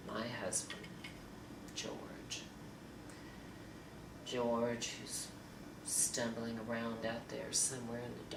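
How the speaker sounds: soft, low and heavy with regret; subdued, sorrowful confession; quiet regret. Acted. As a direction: confess it quietly, dropping almost to a whisper. Acted monologue of marital regret.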